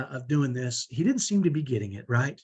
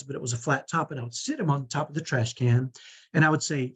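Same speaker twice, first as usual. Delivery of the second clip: ordinary delivery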